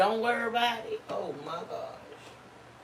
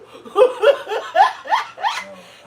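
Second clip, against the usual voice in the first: big high cackling laugh; loud high laugh; 11 dB louder than usual. Real, not acted. Wife laughing at her impression of husband, loud laugh.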